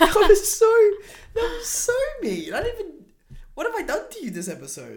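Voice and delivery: squeaky, very high mock-outraged protest; a wounded squeal, hammed up for laughs; mock offended. To co-host who just called her mean, a very high mock-hurt squeal.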